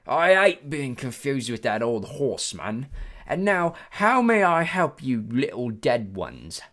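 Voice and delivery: condescending British ferryman; British-like accent. A narrator's reading. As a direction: draw it out grandly in a British accent. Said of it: "He had a strange accent. British maybe".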